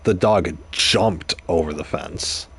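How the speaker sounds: slow line with "jumped" set apart and stretched; single out the word "jumped"; stress on JUMPED. Shown as a demonstration. Teacher contrasting jumping with running around, stress on "jumped". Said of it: "depending on where the emphasis is put".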